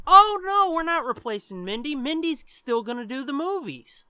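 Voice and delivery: high-pitched, exaggerated reassuring voice; mock reassurance. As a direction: imitate someone hastily soothing an objection, starting loud. Acts out others' reassuring reply.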